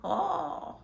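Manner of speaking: high laughing theatrical wizard. Acted. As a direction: cackle like a merry old wizard. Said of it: "Because I'm a wizard".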